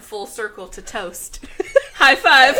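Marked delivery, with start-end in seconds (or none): 1.7-2.6 screaming with laughter; cracking up loudly; laughing hysterically. Real, not acted. Laughing hard together.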